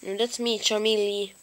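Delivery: usual speaking voice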